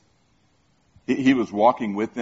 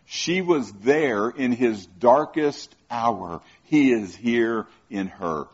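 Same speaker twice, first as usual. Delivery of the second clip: slow emphatic preaching; slower than usual. Real, not acted. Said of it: "sermon parallel, slow emphasis".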